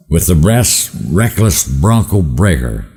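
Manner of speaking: ordinary delivery, nothing marked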